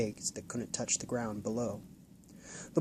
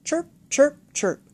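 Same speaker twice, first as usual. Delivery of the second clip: very high playful bird chirps; boy's chirping; 14 semitones higher, 7 dB louder than usual. Acted. Boy imitating the bluebird, playful chirps. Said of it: "Will giggled".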